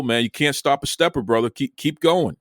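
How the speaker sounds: normal talking voice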